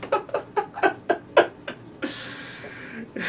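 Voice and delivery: long, high hysterical cackle; maniacal laugh. Acted. Joker over telegraph poles, helpless mad cackling. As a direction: laugh until it trails off.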